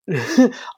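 high giggle, a bit uneasy; nervous laugh. Real, not acted. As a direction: let a short high laugh slip out. Laugh alone after science name.

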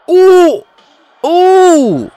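high, loud ooh at a hard hit; excited wince. Real, not acted. Watching Rocky get knocked down, high excited oohs. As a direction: cry out as the punch connects.

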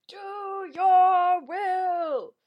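long, drawn-out high holler; mother's yell. Retold or imitated. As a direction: call it across the house, every word stretched. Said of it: "And she yells".

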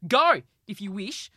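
loud, high furious roar; roaring rage. Acted. Njord dismissing his wife, furious roar. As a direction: roar him off, beside himself. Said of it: "roared Njord, beside himself with rage".

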